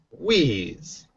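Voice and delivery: ordinary talk, nothing marked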